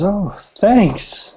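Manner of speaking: high-pitched flattered thanks; flattered lady. Acted. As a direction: voice a charmed lady receiving a drink. Tipsy lady thanks for drink.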